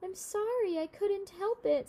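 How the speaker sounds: put-on high, apologetic little boy; sorry little boy, voiced high; child's voice. A narrator's reading. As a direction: plead like a small boy. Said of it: "said Tol".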